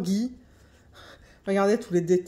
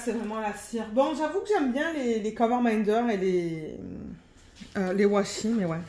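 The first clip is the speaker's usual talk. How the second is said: ordinary delivery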